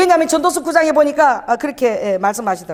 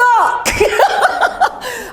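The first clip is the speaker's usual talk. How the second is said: high laugh; 10 semitones higher than usual. Real, not acted. Laughs at own retold outburst.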